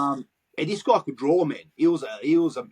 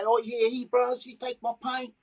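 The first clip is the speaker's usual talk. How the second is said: a hint of a whiny snitch, slightly higher; mock-whine the complaint lightly; whiny weakling; 7 semitones higher than usual. Youth imitating a weak kid who gives up his paint, lightly whiny.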